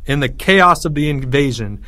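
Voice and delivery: ordinary delivery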